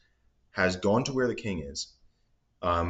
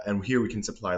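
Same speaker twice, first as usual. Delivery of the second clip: ordinary talk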